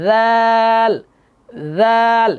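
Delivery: "জাল" as lisped "dhaal", long and loud; tongue between teeth, then hold "জাল"; careful letter sound. Shown as a demonstration. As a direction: chant Arabic "জাল" on a sustained note. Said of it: "একটু খেয়াল করেন".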